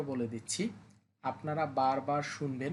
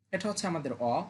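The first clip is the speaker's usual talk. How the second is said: normal talking voice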